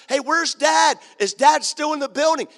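high, loud anxious questions to the workers; urgent, fearful calls for news of Dad; worried calling. Retold or imitated. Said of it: "He was worried".

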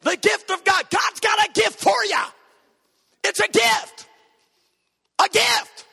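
high, loud pulpit yelling; hammed-up full-force preaching; preacher shouting. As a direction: shout each phrase like a novice preacher. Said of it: "and so I shouted".

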